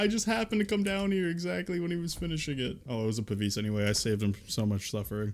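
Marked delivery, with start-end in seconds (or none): 0.0-2.8 high, guilty whine; sheepish whine. Real, not acted. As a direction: whine it out, embarrassed. Guilty whine after stealing kill.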